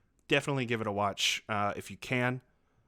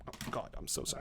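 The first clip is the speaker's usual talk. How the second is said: whispered; 11 dB quieter, much faster than usual. Real, not acted. Apology after swearing at host.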